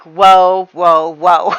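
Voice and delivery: loud stretched whoas; calming whoa. Acted. Father stepping into the daughters' fight, loud calming whoa. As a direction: break up the fight. Thomas breaks up argument.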